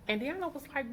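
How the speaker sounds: ordinary delivery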